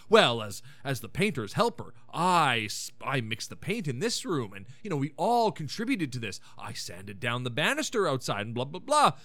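mock pompous speech, pitched low; self-important speech. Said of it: "gives a big asshole speech".

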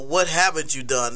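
usual speaking voice

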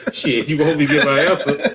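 words spilling out through real laughter; laughing. Real, not acted. Guest laughing at the host's question, talking through laughter. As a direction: laugh your way through the line.

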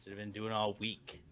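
quiet, low dejection; glum, subdued admission; upset. Real, not acted. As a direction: sulk softly into yourself. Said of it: "I'm upset".

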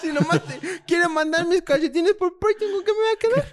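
rising into a squeaky high complaint; mock high whine. Mock complaint about his socks.

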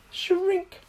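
high, stretched spell word; magical command. A narrator's reading. Little Miss Magic shrinks Mister Tickle's arm, high command. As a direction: give the order on a high note.

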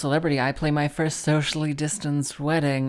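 loud, punchy show teaser; podcast intro announcer. Acted. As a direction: announce the episode's highlights at full projection. Reads show teaser over intro.

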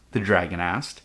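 plain reading voice

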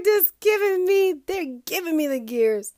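high-pitched comic exasperation; exasperated. Real, not acted. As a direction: complain in a high voice, worn down by them. Exasperated rant about smokers, after laughing.

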